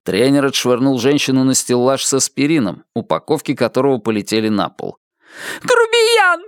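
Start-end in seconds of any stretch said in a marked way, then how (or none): 5.6-6.4 very high-pitched woman's shout; high indignant yell; outraged cry. A narrator's reading. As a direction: hurl the insult in a high voice. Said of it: "крикнула женщина".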